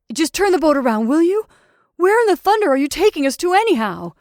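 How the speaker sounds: loud, high, speeding up; angry demand. A narrator's reading. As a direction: demand answers heatedly. Raging man orders the oarsman.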